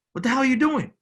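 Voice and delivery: high, loud scolding yell at a friend screwing up; mock yell. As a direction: shout it like a buddy snapping you awake. Acting a buddy scolding.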